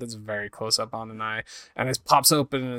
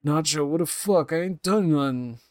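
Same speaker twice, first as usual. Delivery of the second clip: put-on protesting drunk; 5 semitones higher than usual. Drunk protests being dragged out.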